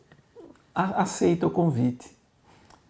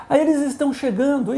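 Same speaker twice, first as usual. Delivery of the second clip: high, louder frightened blurt; sudden panic; 9 semitones higher, 5 dB louder than usual. Retold or imitated. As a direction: warn in a rush of fear. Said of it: "de repente ela falou assim".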